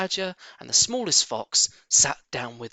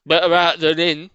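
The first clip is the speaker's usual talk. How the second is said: jolly; 2 semitones higher, 8 dB louder than usual. Acted. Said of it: "said Badger".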